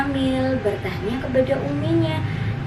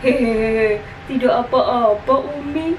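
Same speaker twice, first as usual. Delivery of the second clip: put-on louder giggly little-boy voice; sheepish child; 4 dB louder than usual. Syamil brushes off his mother's question about hunger, giggly child voice. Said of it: "jawab Syamil seraya menggeleng-gelengkan kepala".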